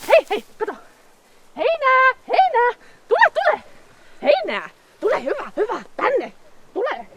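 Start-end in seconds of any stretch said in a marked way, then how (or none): 1.6-7.1 high, eager luring call; bright high sing-song coaxing. Acted. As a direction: tempt the animal over with hay. Luring camel with hay.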